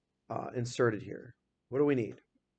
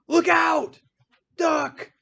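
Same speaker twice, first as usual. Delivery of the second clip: exclamatory shout; 14 semitones higher, 8 dB louder, much slower than usual. Shown as a demonstration. Teacher shouting an example of an exclamatory sentence. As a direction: Look out! shouted high and loud. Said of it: "Exclamatory is like".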